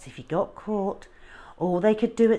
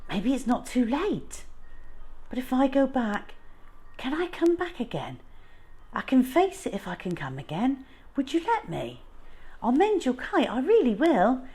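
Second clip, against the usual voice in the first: put-on hopeful pleading boy; 7 semitones higher than usual. A narrator's reading. Boy pleads to come back.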